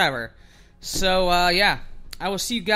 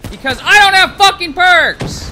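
high, loud furious shout; exploding at the game; real rage; 9 semitones higher, 11 dB louder than usual. Real, not acted. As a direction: yell it in utter frustration. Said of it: "I was raging a little bit there".

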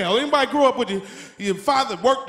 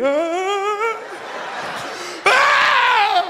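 put-on higher kid's wail; a kid waking alone in the dark; mock crying scream; 8 semitones higher than usual. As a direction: bawl like a scared kid.

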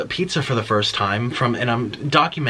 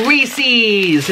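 higher, brighter announcement; proud showy reveal; excited reveal; 7 semitones higher than usual. Real, not acted. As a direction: present it like a prize. Presenting candy.

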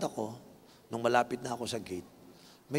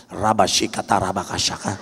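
louder mock tongues babble; imitating ecstatic prayer babble; speaking in tongues; 6 semitones lower, 7 dB louder than usual. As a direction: rattle off nonsense like tongues. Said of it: "Lahat nag-speaking in tongues".

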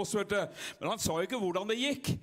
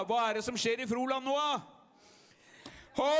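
full-force preaching; 4 semitones higher than usual. Acted. Pastor firing up the revival meeting, full-force preaching. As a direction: lift the crowd to its feet. Preaching resumes after a dip.

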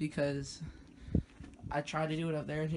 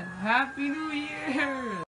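excited; 9 semitones higher, much slower than usual. Real, not acted. Midnight just struck.

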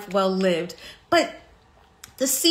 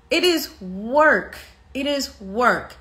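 slow, weighty stress on "work", said twice; insistent emphasis; slower than usual. Real, not acted. Telling viewers the hard truth, slow and insistent on "work". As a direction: draw out "is work" firmly, each time.

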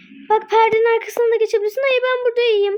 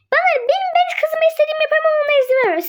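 indignant; 5 semitones higher, much faster than usual. Acted. Mother complains to police.

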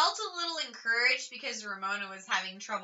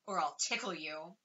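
much softer, lower sly threat; playful threat; 5 semitones lower, 9 dB quieter than usual. A narrator's reading. Beezus softly threatens to tickle Ramona. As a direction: threaten her gently with tickles.

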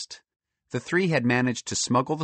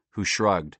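ordinary delivery, nothing marked

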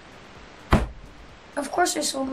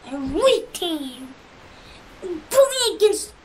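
ordinary talk, nothing marked

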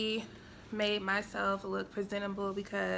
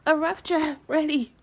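higher, loud proud cheer; triumphant announcement; 7 semitones higher, 9 dB louder than usual. Real, not acted. Vlogger happy to say the draft is done, triumphant.

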